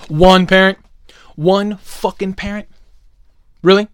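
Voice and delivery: loud, high-pitched indignation; outraged rant. Real, not acted. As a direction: read out the story as if appalled. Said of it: "A K A [name]'s rant hour".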